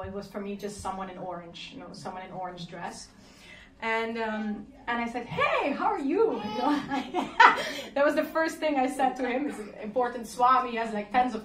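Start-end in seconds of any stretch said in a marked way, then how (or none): 5.3-6.3 high, slow, giggly greeting; laughing hello. Retold or imitated. To the great swami, a laughing casual hello. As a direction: toss off a cheeky hello through a laugh.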